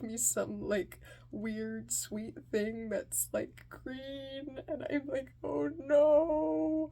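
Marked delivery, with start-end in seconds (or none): 5.4-6.9 high, loud, drawn-out dismay. Retold or imitated. Kid opening the wrong order, dismayed. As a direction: let it out as a wail of dread. Finds he got the wrong food.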